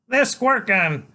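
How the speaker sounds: put-on high, loud cocky little boy; a tiny hero bluffing a killer; bold kid voice. Freak bluffing Killer Kane, high and loud. Child voice.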